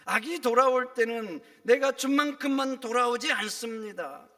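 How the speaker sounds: loud, ringing sermon delivery; preaching at full force. Acted. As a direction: hammer the warning home from the pulpit. (힘차게 외치는 설교)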